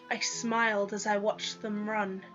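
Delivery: ordinary delivery, nothing marked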